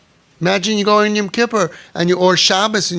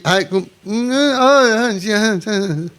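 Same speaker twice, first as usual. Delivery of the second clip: reluctant brush-off; 5 semitones higher, much slower than usual. Retold or imitated. Mimics a reluctant pious brush-off.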